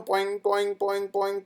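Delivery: high bouncing boing noises; bouncy boing sounds. Acted. Teacher making the excited lam bounce, high boings. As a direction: bounce each boing like a spring.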